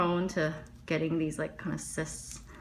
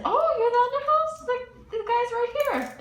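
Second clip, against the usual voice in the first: much higher, shocked mock voice of the bartender; surprised bartender; 7 dB louder than usual. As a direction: squeal it like a startled barman.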